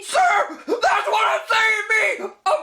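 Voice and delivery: panicky shouting officer. Acted. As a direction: shout every word like a nervous rookie cop. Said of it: "I kinda like that voice for him".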